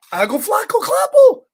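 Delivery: put-on high, loud babbling toddler; toddler babble. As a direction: babble at him like a frantic toddler.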